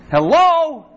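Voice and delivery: a loud, high, sincere call of hello with no sarcasm. Acted. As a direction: call out hello as if waking someone up, earnestly. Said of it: "And it's not sarcastic".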